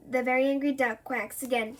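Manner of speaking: ordinary delivery, nothing marked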